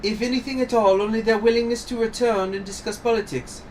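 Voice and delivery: high-pitched, composed lady's voice; composed accused madame. Acted. Brothel keeper defending herself before the judge, high composed voice. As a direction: defend yourself in a high, proper voice.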